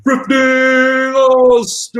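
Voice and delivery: loud, high call of "Thrifty Austin". Acted. Mate hypes up a viewer's name, with a loud high call. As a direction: belt the name out like a crowd cheer.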